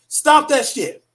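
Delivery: normal talking voice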